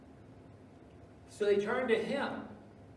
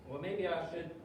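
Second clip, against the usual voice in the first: ordinary delivery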